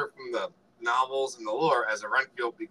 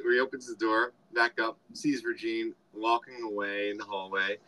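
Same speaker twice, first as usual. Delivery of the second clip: normal talking voice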